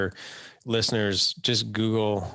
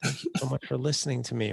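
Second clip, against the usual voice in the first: lightly voiced wife, touched; grateful wife; 5 semitones higher than usual. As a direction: gush with relief at being heard. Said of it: "she's like".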